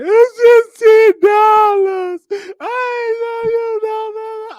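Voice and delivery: high, loud, slow mock sobbing wail; exaggerated sobbing voice, stretched out and shrill; crying woman. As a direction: wail it like a hammed-up crying lady. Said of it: "she started crying in the sock".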